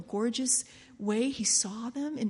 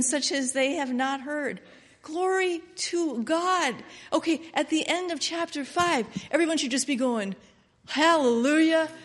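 fervent preaching; 6 dB louder than usual. Acted. Loud sermon reading and chant.

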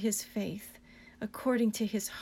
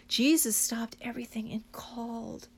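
whisper. Real, not acted. Preacher whispering in awe of Jesus' call. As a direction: let the awe hush you.

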